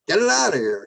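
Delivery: high, mock yell at the driver; shouting a racer off the line, retold with a laugh. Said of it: "And I'm screaming at".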